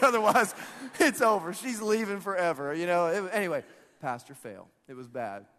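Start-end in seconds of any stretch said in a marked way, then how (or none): 0.0-2.7 loud, higher, hammed-up dread; mock desperate outburst. Pastor praying about the mom, mock desperation. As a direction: blurt it out as comic despair. Acted prayer after pastor fail.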